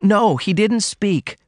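high and slow, patiently explaining. A narrator's reading. Daughter correcting her mother, high and slow. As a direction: spell it out gently to her mother. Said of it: "Ruth explained".

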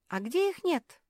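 ordinary delivery

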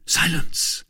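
high, half-whispered warning; startled hush. A narrator's reading. Woman startled by a noise outside, urgent hush.

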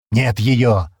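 loud, growled retort; angry growl. A narrator's reading. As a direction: growl it out in anger. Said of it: "прорычал Стэн и зло выругался". (злобное рычание)

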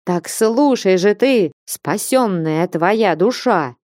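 plain reading voice

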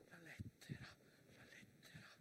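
whispered with longing. Acted. Narrator lingers on the soldiers' letters, whispered. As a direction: breathe the word softly.

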